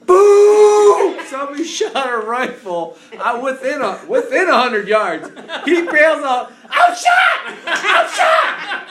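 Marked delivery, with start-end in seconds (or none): 0.1-1.2 very loud, high, drawn-out boom; explosive mock gunshot; shouted gunshot boom. Imitating a sudden rifle shot. 6.7-8.9 very high, loud shriek; hammed-up terror; mock panicked scream. Cousin bailing out, thinking he's shot.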